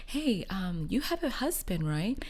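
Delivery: high, sweet prying voice of a nosy lady; imitating a stranger. Wife imitating the nosy lady, high sweet voice. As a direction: coo the compliment up high. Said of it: "she suddenly says".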